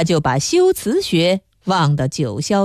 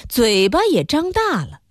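plain reading voice

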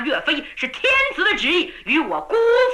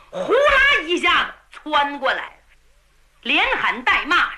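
ordinary delivery, nothing marked

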